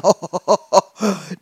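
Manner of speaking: loud acted ho-ho laughing fit; uncontrollable laughter. A narrator's reading. Farmer can't stop laughing, helpless laughter. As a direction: keep laughing, unable to stop. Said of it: "nicht wieder einkriegen vor Lachen".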